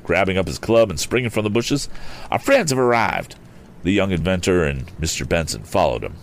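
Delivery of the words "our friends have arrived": higher-pitched eager call; crying out. A narrator's reading. Mister Damon announces help to Tom, high eager call. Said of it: "cried Mister Damon".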